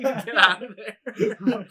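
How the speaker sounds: slow, high, loud yell through laughter; mock screaming. Retelling the locked-door scene, laughing mock scream. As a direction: drag out the pretend scream. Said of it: "And he's, like, screaming".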